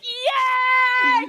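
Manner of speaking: high, loud little cheer; excited cheer. Retold or imitated. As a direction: cheer out a happy yay. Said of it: "we were like".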